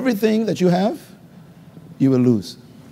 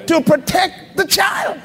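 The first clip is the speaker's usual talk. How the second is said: emphatic shout; 8 semitones higher, slower than usual. Real, not acted. Pastor answers his own question.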